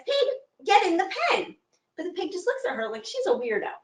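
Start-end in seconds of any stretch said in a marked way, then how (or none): 0.1-1.6 put-on high, old woman voice telling the pig; old woman's order. Old woman tells her stubborn pig to go in. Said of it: "she says".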